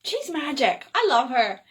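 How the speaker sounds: plain reading voice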